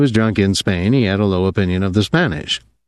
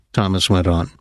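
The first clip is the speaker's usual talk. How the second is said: plain reading voice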